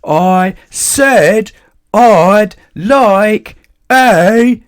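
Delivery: very slow, high roar; booming roar. A narrator's reading. Mister Noisy roars at the butcher before stopping. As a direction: roar it out slowly. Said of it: "and then he stopped".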